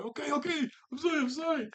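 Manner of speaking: very high, comic frantic pleading; panicked apology. Retold or imitated. Aang pelted with firecrackers by Momo, panicked apology. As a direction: squeal out a mock panicked apology.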